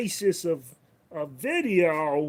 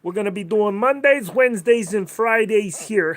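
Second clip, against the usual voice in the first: put-on thick Queens accent, higher and louder; New York accent; 5 semitones higher, 9 dB louder than usual. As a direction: ham up a Queens New Yorker announcing the schedule. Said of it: "talking like this".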